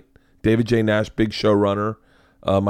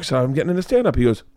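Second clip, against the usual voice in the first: ordinary talk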